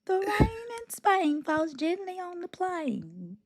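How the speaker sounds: hammed-up posh English, slow and lilting; posh British quote. Quoting My Fair Lady to friends, a slow, high, hammed-up posh English lilt. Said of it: "Is that My Fair Lady?".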